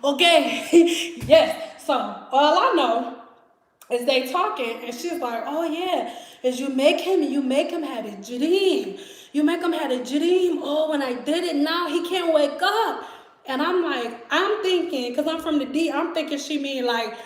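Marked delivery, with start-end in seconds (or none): 5.4-13.0 imitated Haitian accent. Roommate explains her trick while cooking, imitated Haitian accent. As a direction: mimic a Haitian woman sharing her secret. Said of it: "these Haitian roommates".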